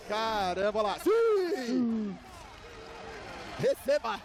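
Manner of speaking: shouted goal celebration; explosive goal commentary. Real, not acted. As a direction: burst out at the goal. Live goal reaction shouting.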